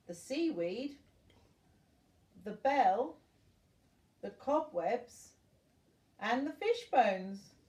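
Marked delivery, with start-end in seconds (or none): none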